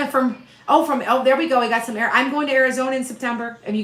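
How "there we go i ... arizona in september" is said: normal talking voice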